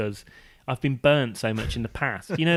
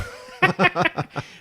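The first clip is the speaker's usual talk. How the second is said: high burst of laughter; 5 dB louder than usual. Real, not acted. Laughs at imitation.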